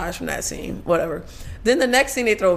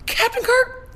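high-pitched incredulous question; hammed-up puzzlement, voice lifted way up; mock disbelief; slower than usual. Guessing which Kirk fathered the baby, mock disbelieving. Acting out shock at baby news.

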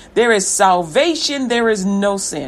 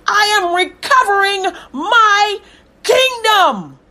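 raised high, building to a cry on kingdom; fervent God voice; 6 semitones higher than usual. Acted. Preacher voicing God to the congregation, rising to a tearful cry. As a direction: preach God's promise rising into a near sob.

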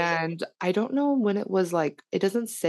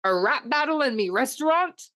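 higher-pitched, lightly voiced crab boss; Mister Krabs; 6 semitones higher than usual. A narrator's reading. Restaurant boss reacting to a rap battle, lightly voiced. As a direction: put on a hint of a cartoon boss. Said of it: "Mister Krabs came outside of his office".

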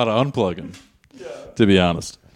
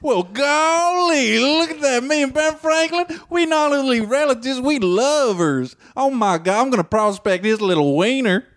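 put-on old prospector; 14 semitones higher than usual. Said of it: "character and you voice it real quick".

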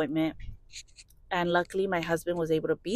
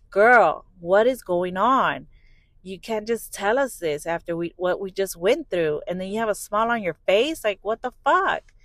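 retold outburst, punched opening word; emphatic, incredulous mock confrontation; exasperated demand; 5 dB louder than usual. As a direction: act out demanding answers from someone holding back. Retold demand to the smiling nurse.

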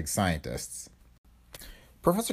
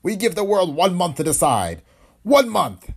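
high, emphatic proclamation; dictator's ultimatum; 8 semitones higher, 4 dB louder than usual. A narrator's reading. Dictator giving the world one month, emphatic ultimatum. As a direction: declaim it as a final demand to the world.

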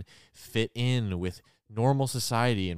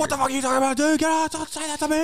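high, loud outburst of rage; furious yell; 4 dB louder, faster than usual. Retold or imitated. Bobby screaming at Beast, high furious yell. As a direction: explode at him like he's been triggered. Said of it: "And Bobby's like".

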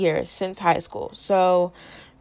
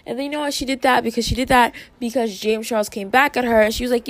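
ordinary talk, nothing marked

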